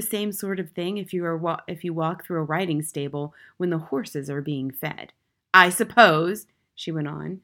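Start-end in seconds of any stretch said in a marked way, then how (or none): 5.5-6.5 loud, pointed scorn; tyrannical headmistress, scornful. A narrator's reading. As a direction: announce it loudly with contempt.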